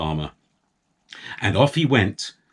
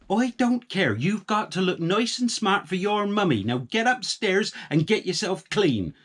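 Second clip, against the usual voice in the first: high, firm and no-nonsense; a sharp farm-wife order; bossy wife voice; 11 semitones higher than usual. A narrator's reading. Wife refusing the farmer's moaning.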